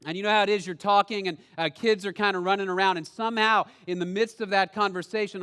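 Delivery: ordinary talk, nothing marked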